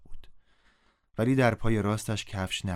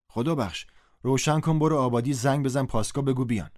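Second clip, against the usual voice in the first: raised, urgent instructions; louder, higher command to a neighbour; calling out orders; 5 semitones higher, 4 dB louder than usual. Acted. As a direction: send someone off for help. Villager sends man to call police.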